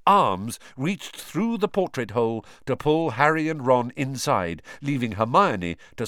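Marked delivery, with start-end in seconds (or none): none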